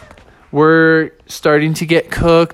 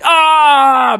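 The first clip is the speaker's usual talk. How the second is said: high, loud cartoon yell; mock scream; 9 semitones higher, 5 dB louder than usual. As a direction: yell out as ants attack. Bear's face attacked by ants.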